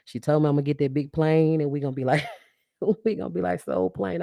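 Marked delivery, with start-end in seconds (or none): 2.8-4.2 higher, laugh breaking into the words. Real, not acted. Host joking about the big plane, laughing. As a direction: say it through a laugh.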